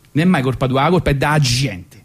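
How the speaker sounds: ordinary delivery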